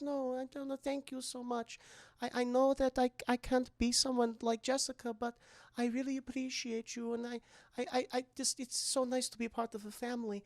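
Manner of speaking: mild mock weepy, soft and high; half-hearted teary gratitude; tearful thanks. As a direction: Esther thanks her mom, softly and teary. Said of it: "starts crying".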